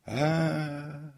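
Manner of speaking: high drawn-out "aaa" echo; echoing cry. A narrator's reading. Empty theatre answers the comic's shout, echo. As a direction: hold a high, hollow vowel like an echo. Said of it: "ответило эхо".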